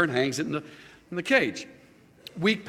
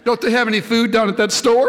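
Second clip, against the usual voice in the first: louder punchline through laughter; laughing punchline; 6 semitones higher, 6 dB louder than usual. Real, not acted. Preacher delivers the parrot joke punchline, laughing. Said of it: "He said".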